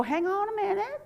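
high perky teacher voice; high perky teacher soothing babies. Retold or imitated. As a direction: chirp it brightly to the babies. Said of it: "with my perky voice".

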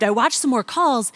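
normal talking voice

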